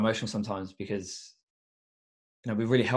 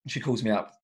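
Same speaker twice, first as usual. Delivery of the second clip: ordinary talk, nothing marked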